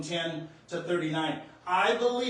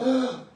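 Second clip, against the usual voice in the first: loud gasping ugh of wonder; astonished gasp; 6 semitones higher, 7 dB louder than usual. Retold or imitated. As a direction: let the shock burst out. Gasp when mother turns.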